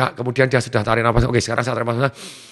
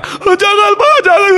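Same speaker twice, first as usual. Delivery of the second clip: very high pleading scream, acted; imitated scream; 5 dB louder, slower than usual. Little sister begging papa to stop, screaming. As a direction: shriek it like a terrified little girl. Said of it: "adik saya teriak".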